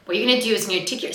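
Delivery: normal talking voice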